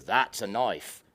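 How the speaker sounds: slow, low, unhurried Crocodile Dundee knife line; slow, low, cocky Aussie hero; Dundee's boast. Retold or imitated. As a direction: drawl it out like Dundee sizing up the mugger. Dundee tops the mugger's knife.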